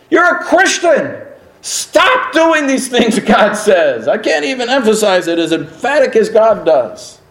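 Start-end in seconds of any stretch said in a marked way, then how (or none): 0.1-4.1 high, slow and forceful; stern rebuke. Real, not acted. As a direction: scold them sharply. Preacher scolding the congregation.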